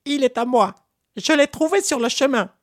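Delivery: very high, scoffing monkey voice; mocking monkey reply. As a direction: toss the question back with a sneer. Monkey claims the rice.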